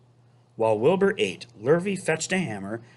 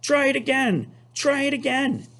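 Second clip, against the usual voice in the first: high, urgent goose voice; high honking encouragement; eager goose; 14 semitones higher than usual. Acted. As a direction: push him to try once more. Goose keeps urging Wilbur.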